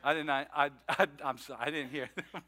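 quiet, sheepish, half-laughing denial; mock innocent murmur to the wife; sheepish mumbled excuse. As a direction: play dumb softly, like you just woke.

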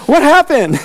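high-pitched incredulous question; mock disbelief. Disciples asking Peter about his failure, mock disbelief. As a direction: blurt it high in comic bafflement.

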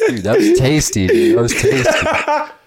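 words bubbling through laughter, high; laughing. Real, not acted. Friend laughing, calling the moment tasty.

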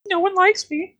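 very high, squeaky chicken whine; a sad little chicken complaining in a high squeak; talking chicken voice. Acted. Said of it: "Why am I using this voice?".

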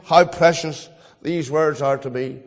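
usual speaking voice

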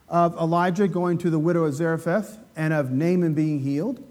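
ordinary talk, nothing marked

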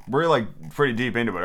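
ordinary delivery, nothing marked

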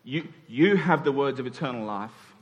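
usual speaking voice

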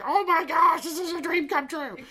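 put-on high, squealing little boy thrilled; excited kid voice. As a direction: play the four-year-old overjoyed at the toy. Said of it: "he's just like".

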